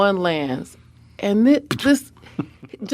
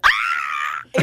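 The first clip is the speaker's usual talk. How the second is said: starstruck shriek. Retold or imitated. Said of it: "I'm like".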